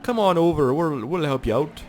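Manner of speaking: put-on high, cheery Canadian accent; hammed-up nice Canadian policeman; friendly Canadian cop. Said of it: "Canadian cops look all nice".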